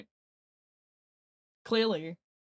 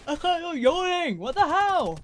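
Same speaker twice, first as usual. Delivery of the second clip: higher, louder, a shocked outburst; outraged shout; 9 semitones higher, 6 dB louder, slower than usual. Real, not acted. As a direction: yell in disbelief at the game. Outraged at being made to yawn.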